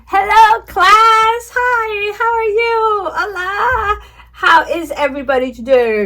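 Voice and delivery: bright, high, loud kindergarten teacher voice; teacher to young kids. Shown as a demonstration. Teaching demo to imaginary class.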